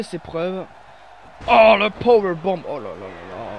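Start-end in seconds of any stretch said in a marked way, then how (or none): 1.5-2.6 loud shout of the powerbomb; excited shout. Real, not acted. 2.7-3.5 dismayed groan. Real, not acted. Reaction after powerbomb.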